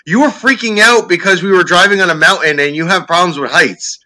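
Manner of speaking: high, loud exasperated explaining; exasperated wife. Retold or imitated. Wife loudly setting the comic straight about the panic. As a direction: set him straight, exasperated. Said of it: "And she goes".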